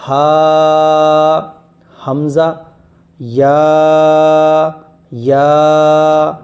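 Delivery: با and تا held long, one breath each; slow Quran teacher drawing out each letter; drawn-out letter names. Shown as a demonstration. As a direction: stretch با then تا out slowly. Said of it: "کھینچ کھینچ کر پڑھنا ہے".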